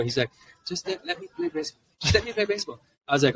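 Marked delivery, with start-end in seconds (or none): 0.7-1.8 put-on high, begging child voice; pleading kid. Son pleading with dad. 2.0-2.8 a little high, steadier plea; pleading kid, calmer. Retold or imitated. Son pleading with dad.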